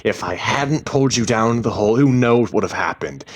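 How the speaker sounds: loud, heated self-justification; agitated, high-volume argument; shouting back. A narrator's reading. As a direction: keep yelling your defence, words tumbling out. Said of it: "he shouted back".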